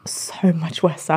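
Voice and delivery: slow, whispered, half-joking; whispered dread. Real, not acted. Singer admits growing nerves, whispering slowly. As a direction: breathe it out slowly like a confession. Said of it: "The pressure is getting worse".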